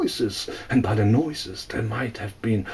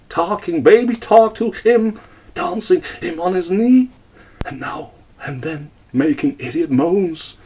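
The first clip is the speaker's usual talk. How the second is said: plain reading voice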